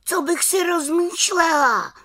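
high-pitched indignant cry; exclaiming little witch. A narrator's reading. Little witch answers the summons to the council, exclaiming. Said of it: "vykřikla malá čarodějnice".

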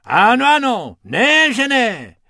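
high, loud insistence; overriding the rabbit; insisting hippo. Acted. Hubert insists loudly over Bobek.

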